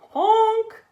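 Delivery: high, loud startled honk; a frightened horn cry; a truck's panicked honk; scared honk. A narrator's reading. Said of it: "he sounded scared".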